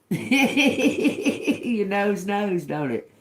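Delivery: high, slow, laughing delight on Your nose knows. Real, not acted. Tarot reader to viewers, laughing high and slow. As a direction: giggle it out, drawn slowly.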